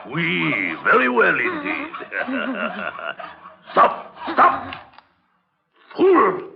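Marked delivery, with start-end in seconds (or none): 2.0-3.5 high, gloating laugh; long pleased madman's laugh; mad chuckle. Acted. Madman pleased with captive's work. 3.7-6.5 loud, high one-word outbursts; sudden exasperated yell; angry shout. Acted. Captive cuts bone wrongly.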